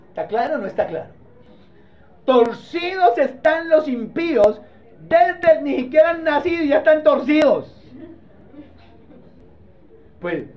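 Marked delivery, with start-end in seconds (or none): 2.2-7.7 preacher hammering out the verse; emphatic scripture preaching. Acted. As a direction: drive each phrase home from the pulpit. Declaims scripture, paraphrased, to congregation.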